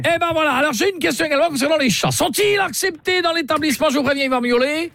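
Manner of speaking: hammed-up snooty client lecturing the hotel clerk; mock-offended caller. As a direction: scold him like a snooty know-it-all.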